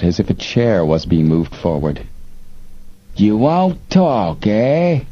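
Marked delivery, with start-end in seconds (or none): 3.2-5.0 slow, high-pitched threat, dragged out; ugly menace. A narrator's reading. Smuggler chief threatening the silent prisoner, slow and menacing. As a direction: lean into the menace, slowly. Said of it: "The leader's voice grew ugly".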